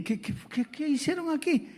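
high startled exclamation; shocked. Retold or imitated. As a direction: gasp it out in disbelief. Shocked at the toad. (sorpresa alarmada)

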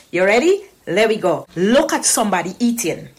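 usual speaking voice

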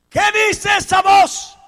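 very slow, high and loud; demanding question. Acted. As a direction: drag out the challenge.

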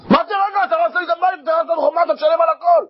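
furious homeowner. Acted. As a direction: scream at him for wrecking the house. (צעקה זועמת וגבוהה של בעל הבית)

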